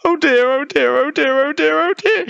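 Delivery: very high, rattled chant; high-pitched panicky bear; fretting polar bear. A narrator's reading. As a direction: babble it high while tumbling. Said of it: "she muttered as her big furry bottom".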